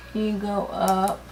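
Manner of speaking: normal talking voice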